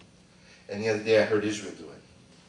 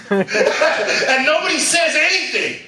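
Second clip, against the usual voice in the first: laughing; 10 dB louder, slower than usual. Real, not acted. Laughs at own story.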